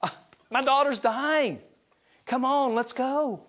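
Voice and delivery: high anxious plea from a frantic dad; Jairus pleading. Retold or imitated. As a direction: beg him to hurry, a desperate father. Jairus voiced in his panic.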